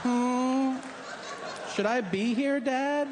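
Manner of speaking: put-on high, timid child voice; a scared little kid asking his dad; timid dick.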